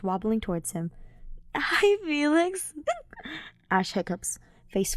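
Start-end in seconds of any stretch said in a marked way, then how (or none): 1.6-2.7 put-on much higher, slow drunk sing-song; high, slow, drunken hello; tipsy greeting. Drunk Ash. 2.8-3.4 high tipsy giggle; soft high giggle; drunk giggle. Acted.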